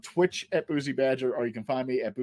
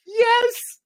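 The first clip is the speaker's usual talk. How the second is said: uncontrollable giggling. Retold or imitated. Comedian re-enacting being high on the panel, uncontrollably giggling. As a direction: imitate a helpless high-pitched giggle, then squeal the answer. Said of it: "I couldn't stop giggling".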